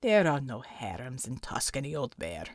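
deep, soft growling voice; low growl. A narrator's reading. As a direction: growl it low at the old bear. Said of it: "growled Unter".

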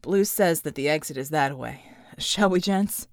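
low, tired soldier's voice; grim and worn down; weary character voice. Acted. John muttering to his team, low and exhausted. John's voice.

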